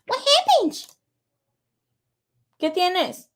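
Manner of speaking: very high-pitched coo; cooing pet talk. Real, not acted. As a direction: fuss over a little pet in a squeaky-high voice. Cooing to Leo, apparently a pet.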